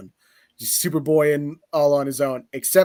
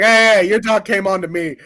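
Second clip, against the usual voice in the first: louder, higher mock indignation; possessive protest; 5 semitones higher, 6 dB louder than usual. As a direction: get defensive about the dog. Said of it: "possessive of him".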